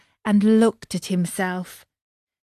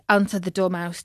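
ordinary delivery

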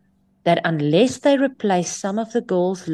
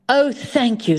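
nurse's thanks; 7 semitones higher than usual. Retold or imitated. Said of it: "the nurse said in French".

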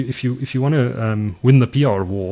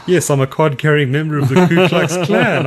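laughing; 6 semitones higher, 6 dB louder than usual. Pretend. Mock answer, laughing.

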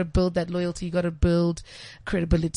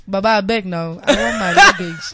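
louder pleading Pidgin at the airport; Nigerian Pidgin; 6 dB louder than usual. Retold or imitated. Traveller at the airport pleading in Nigerian Pidgin. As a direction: beg for the luggage in Pidgin, laughing. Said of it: "you just like".

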